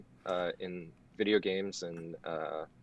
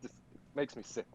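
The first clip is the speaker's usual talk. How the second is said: sarcastic; 9 dB louder than usual. Acted. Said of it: "yes, that was sarcasm".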